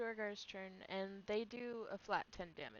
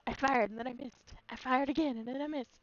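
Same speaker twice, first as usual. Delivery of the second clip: meme quote; 3 semitones higher, 9 dB louder than usual. Retold or imitated. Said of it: "what's that meme".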